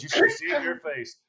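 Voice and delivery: high-pitched mock taunt; lively retold teasing. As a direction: call out the jab, pitch up. Her parting jab, first take.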